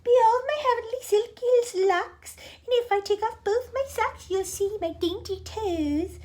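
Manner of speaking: vain Aunt Sponge voice. Acted. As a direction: show off your beauty in a high, silly voice.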